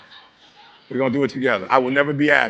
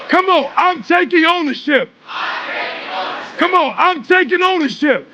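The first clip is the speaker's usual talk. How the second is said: preaching at full force; 10 semitones higher than usual. Acted. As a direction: whip the room into a chant. Fires up the crowd.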